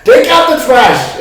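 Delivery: high, loud yelling father impression; Vibo's dad. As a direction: holler it slowly like somebody's exasperated dad. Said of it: "it was the impression of Bibles dad".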